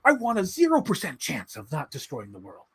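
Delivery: lightly voiced solemn movie hero; a hint of grave blockbuster seriousness; Matt Damon. Still mocking the actor's line, mildly solemn. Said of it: "he's like".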